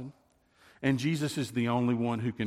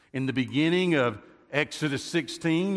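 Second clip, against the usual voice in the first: ordinary delivery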